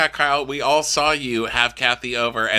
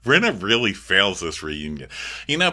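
ordinary talk

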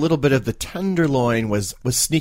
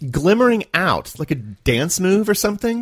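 surprised; 6 semitones higher than usual. Real, not acted. Puzzled reaction to odd term.

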